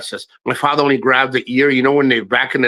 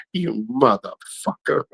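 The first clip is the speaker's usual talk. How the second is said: muttered curse; 2 semitones lower, 6 dB quieter, slower than usual. Retold or imitated. Cursing the father quietly, slow and soft. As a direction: mutter it under your breath.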